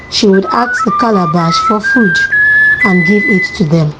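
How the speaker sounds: ordinary delivery, nothing marked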